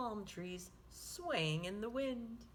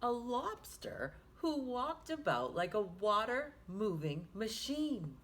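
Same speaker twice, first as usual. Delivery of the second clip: ordinary delivery, nothing marked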